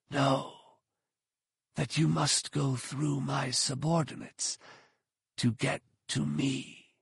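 soft, slow sinister whisper. A narrator's reading. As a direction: breathe it like a cold master. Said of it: "The hooded man's voice came back as a whisper".

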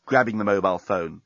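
usual speaking voice

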